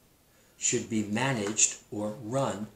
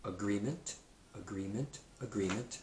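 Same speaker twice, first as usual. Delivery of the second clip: "Agreement" said three times, each one much softer; repeat, fading; 9 dB quieter than usual. Shown as a demonstration. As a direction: let "Agreement" drift quieter with every repeat. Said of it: "Next word".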